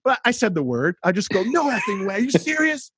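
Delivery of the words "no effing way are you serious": retold disbelief, rising high on serious; stunned disbelief. Retelling the moment his kids won, stunned excited disbelief. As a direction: replay the shock, climbing up on serious. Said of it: "and very Christianese was".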